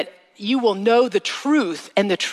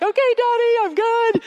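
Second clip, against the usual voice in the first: put-on high, small child's voice; little girl voice. Little daughter to her dad on the ski slope, high and childlike. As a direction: chirp it like a brave little girl on her dad's skis. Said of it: "be like".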